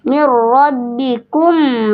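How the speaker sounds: "Mir rabbikum" drawn out slowly with a long held "mir"; slow tajwid demo. Shown as a demonstration. Teacher slowly demonstrating recitation to a student, drawn out. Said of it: "mim sukun bertemu huruf ro".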